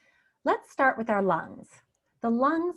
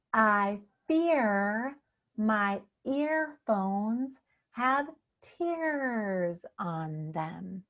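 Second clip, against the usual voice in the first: very slow, stretching the ear in "fear", "earphones", "tears"; slow tongue twister; much slower than usual. Shown as a demonstration. Coach modelling a new tongue twister for you, very slow. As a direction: draw out each word, lingering on the ear sounds.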